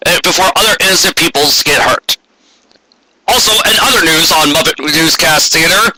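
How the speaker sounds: mock newscaster read, ahem and all; a hammed-up live bulletin voice; TV news anchor. Anchor interrupting the movie with a monster bulletin: mock news read. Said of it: "transmit a special bulletin".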